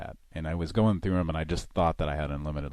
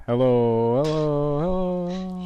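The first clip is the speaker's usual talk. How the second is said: loud, then climbing higher and higher; Three Stooges hello; 10 semitones higher, 9 dB louder, slower than usual. Pretend. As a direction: call out like a slapstick comic. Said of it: "do the Three Stooges thing".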